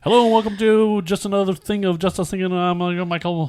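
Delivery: high-pitched, garbled mock intro; exaggerated fast talk. Shown as a demonstration. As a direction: gabble it in a high, hammed-up rush. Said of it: "I, I can't talk that fast".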